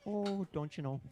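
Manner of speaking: high, lilting mock Minnesotan; hammed-up Minnesota accent. Host playing up his accent bit in high mock Minnesotan.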